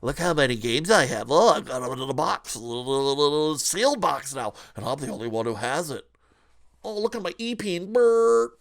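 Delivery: mock scalper. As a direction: gloat over the box like a greedy hoarder. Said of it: "bloated scalper spider and go".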